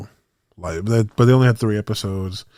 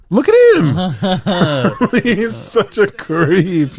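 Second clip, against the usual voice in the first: laughing; 9 semitones higher, 5 dB louder, much slower than usual. Real, not acted. Laughing at creepy video.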